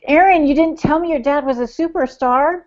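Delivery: high, loud burst of pretend amazement, settling down; mock starstruck surprise. To Aaron on first meeting his dad, playfully starstruck surprise. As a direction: gush like a starstruck fan spotting a celebrity. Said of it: "I was like".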